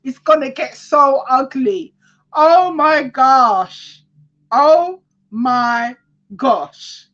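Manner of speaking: high-pitched, carried-away exclaiming; excited. Real, not acted. Reader reacting to the ugly prediction, excited. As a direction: draw it out high, overwhelmed with excitement. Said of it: "but I get excited".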